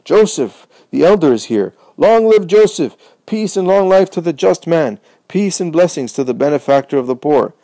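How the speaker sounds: joyful shouts. A narrator's reading. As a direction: hail Joseph like a hero. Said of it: "shouts of joy".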